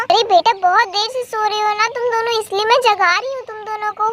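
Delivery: ordinary talk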